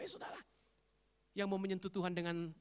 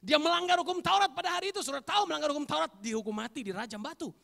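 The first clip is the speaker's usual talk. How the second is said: loud, raised preaching voice; full-force preaching; 9 semitones higher, 10 dB louder than usual. Acted. Preacher warning the congregation about the law, full-force preaching. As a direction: drive the point home at high volume.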